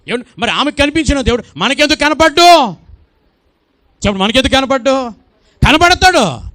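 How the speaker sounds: high, loud challenging cry; fervent preaching. Acted. Preacher challenging the congregation, high and loud. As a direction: press the church hard.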